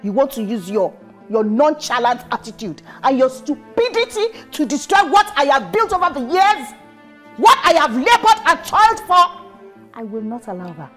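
mother scolding her son, building to fury; angry mother. Acted.

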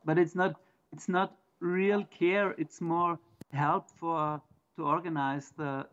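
ordinary talk

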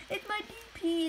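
put-on squeaky high pleading kid voice; whiny kid. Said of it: "this person's like".

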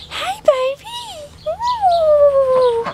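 squeaky high coo; high pet voice. Real, not acted. Greeting her duck, squeaky high coo.

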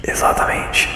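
whispered puzzle-solving, no voice; ASMR whisper. Pretend. Streamer guessing the animal puzzle aloud, ASMR whisper. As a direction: think aloud entirely in whispers. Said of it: "A S M R na caverna".

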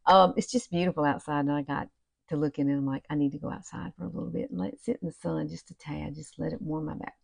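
usual speaking voice

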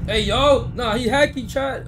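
high loud alarmed call; loud shout at the game; loud outburst. Real, not acted. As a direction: yell it at the screen. Reacting to a hacker in game.

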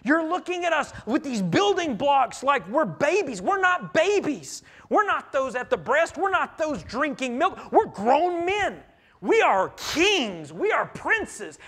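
high, loud, indignant pride, slowing on the boasts; prideful. Retold or imitated. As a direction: puff yourself up, offended, against the prophet. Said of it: "in this prideful smile and they go".

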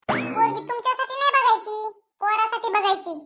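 a tart wife putting her husband in his place; mother's voice. Acted.

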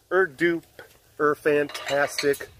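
very slow stretched emphasis; drawn-out hype. Acted. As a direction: stretch it out for effect.

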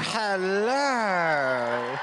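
low, drawn-out seductive hello; sexy hello. Pretend. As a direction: purr it like a lounge singer. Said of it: "give 'em a big sexy hello".

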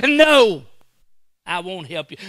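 loud, stern God refusing help. Acted. God answering the sinner, loud stern refusal. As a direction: shut him down as God. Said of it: "God says".

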